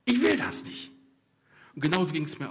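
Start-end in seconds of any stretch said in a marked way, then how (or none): 0.0-0.9 rising to a higher, louder cry of refusal; the prophet crying out against his calling; Jeremiah's protest. Retold or imitated. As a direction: protest that ends high and loud. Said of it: "Er sagt".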